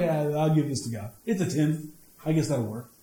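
low, half-hearted shrug of a voice; indifferent Cain. Retold or imitated. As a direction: give it up like it hardly matters. Acting out Cain's indifferent offering.

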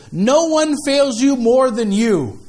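drawn-out proclamation to a congregation; reading out loud. Shown as a demonstration. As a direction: read it out boldly, stretching each word. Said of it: "I'm gonna read it out loud".

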